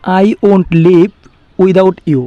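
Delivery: very slow, careful English; slow model sentence. Shown as a demonstration. Teacher giving the English translation, slow and deliberate. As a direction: lay each word out slowly. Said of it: "যাব না বলতে কি হবে?".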